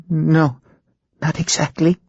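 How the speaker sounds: put-on a scared girl barely answering; frightened young woman. Ginger to the hypnotist, high and frightened.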